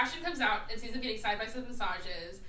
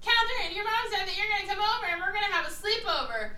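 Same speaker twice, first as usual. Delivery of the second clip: high-pitched calling out from a balcony; hammed-up high sing-out across a distance; mock scream; 8 semitones higher than usual. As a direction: imitate someone hollering down to a friend, high but not full volume. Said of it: "like, screams over the balcony down to Catherine".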